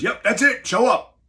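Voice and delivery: high, loud Bronx orders, slow at first; heavy Bronx accent. Retold or imitated. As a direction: bark the orders like a Bronx boss. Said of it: "Very heavy New York Bronx accent".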